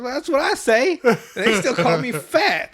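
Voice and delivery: indignant cartoon protest. Cartman telling his mom about being called fat, mock indignation.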